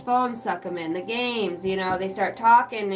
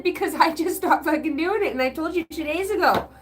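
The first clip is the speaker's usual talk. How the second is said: higher-pitched fed-up retort; exasperated parent; 6 semitones higher than usual. Acted. Parent answering kids about undone laundry, exasperated. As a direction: act out scolding the kids, pitched up.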